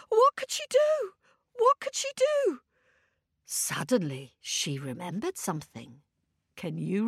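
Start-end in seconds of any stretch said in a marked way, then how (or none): 0.1-1.1 high worried question; anxious question. A narrator's reading. Storyteller asking children in a high anxious voice. 1.6-2.6 high worried question; anxious question. A narrator's reading. Storyteller asking children in a high anxious voice.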